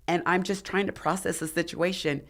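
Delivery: ordinary delivery, nothing marked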